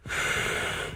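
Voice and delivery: long, voiceless tired sigh; a real exhausted sigh; weary sigh. Real, not acted. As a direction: let out a worn-out breath. Worn out after a long day.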